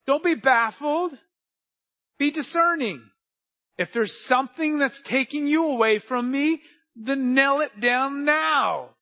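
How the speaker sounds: high, loud, driving sermon delivery; full-force preaching. Real, not acted. Preacher urging church to stand firm, full-force exhortation. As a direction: preach it loud and high, pressing each phrase.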